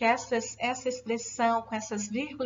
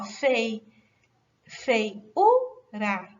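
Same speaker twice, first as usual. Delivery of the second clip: "feiura" broken into fei, U, ra with the U louder; stressed U; 4 dB louder, much slower than usual. Shown as a demonstration. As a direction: hit the "u" in "feiura" as its own syllable. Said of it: "u aqui é uma sílaba tônica". ("feiura" em sílabas, com o "u" tônico e forte)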